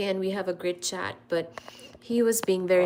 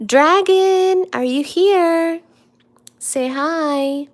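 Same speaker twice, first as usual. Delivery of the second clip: loud call, pitched up; 7 semitones higher, 9 dB louder, much slower than usual. Real, not acted. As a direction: call out across the room. Calling out to absent moderator.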